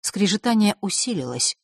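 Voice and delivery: ordinary delivery, nothing marked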